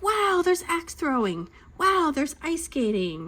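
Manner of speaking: high, drawn-out wows; mock amazement. Mom retelling arriving at the park, mock amazement. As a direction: play an awed visitor, stretched out and high.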